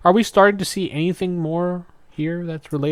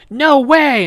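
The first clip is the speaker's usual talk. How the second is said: mock outburst; 11 semitones higher, 6 dB louder than usual. Presto voiced, refusing.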